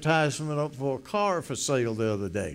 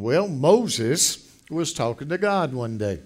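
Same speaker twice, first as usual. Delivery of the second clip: ordinary delivery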